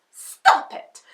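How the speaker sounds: sharp, loud stop command; cried out. Acted. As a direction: snap it out to halt the lion. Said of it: "she cried".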